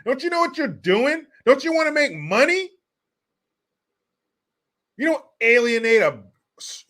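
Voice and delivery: high, loud scolding outrage; indignant rant. Real, not acted. Ranting at an actor who insulted his audience, indignant.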